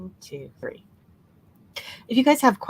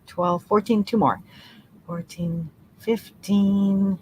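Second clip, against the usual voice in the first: ordinary talk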